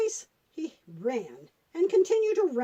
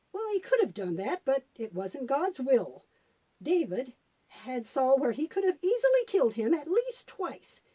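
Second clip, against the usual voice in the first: usual speaking voice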